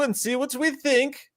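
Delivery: put-on high-pitched, eager foreign-accent voice; comic broken-English accent, high and insistent. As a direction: coax them in a hammed-up foreign accent. Put-on foreigner inviting to watch trailer.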